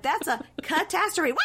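high, shouted mock punchline; hammed-up punning. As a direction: cry out the pun like a giddy jokester. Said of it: "They're like".

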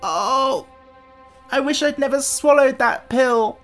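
high, loud cry of regret; pained wail. Acted. Tip regrets swallowing the pill, wailing in pain. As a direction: wail the wish out through pain.

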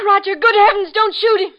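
frightened plea. Acted. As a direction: stop him before he fires. Woman sees gun drawn.

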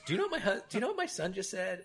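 shocked mom. Retold or imitated. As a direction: squeal it like an outraged mother. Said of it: "she goes".

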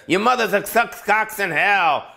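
mock trash talk. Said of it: "Maybe Chris Paul said".

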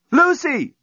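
high, loud call from afar; shouting. Acted. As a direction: call her across the beach. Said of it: "shouting from the breakwater".

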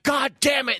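high mock outburst; imitating angry owner. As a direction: act out an owner's exasperated yell. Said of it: "and they're like".

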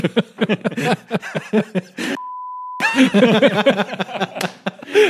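long high-pitched fit of laughing; helpless laughter. Real, not acted. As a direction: crack up completely at the joke. Cracking up at bleep joke. (fou rire aigu et prolongé)

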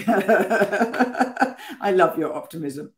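slow and laughing. Real, not acted. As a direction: laugh through the words.

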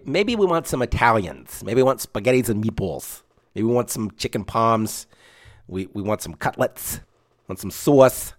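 hammed-up Italian-American accent; attempted Italian accent. Suggesting Italian food to wife, playful Italian accent. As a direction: put on your best mock Italian accent. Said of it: "that's my, my best Italian accent".

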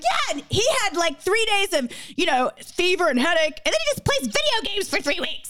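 high, mocking retort about his three weeks; mocking herself to husband. Wife teasing husband about his illness, high mock singsong. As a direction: needle him in a sing-song high voice. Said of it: "I'm like".

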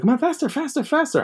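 high-pitched, pushy imitation of uncle; mock drill-sergeant hurrying someone along; urging drill-sergeant uncle. Uncle rushing nephew cleaning fish, high and pushy. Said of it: "He's treating this like the military".

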